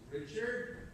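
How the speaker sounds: hushed, as if she can't believe her eyes; soft high disbelieving call of her husband's name; disbelieving recognition. Retold or imitated. Mother spots husband on platform.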